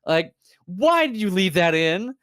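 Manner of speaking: loud, high exasperated why; exasperated outburst. Real, not acted. Streamer venting at game developers, exasperated outburst.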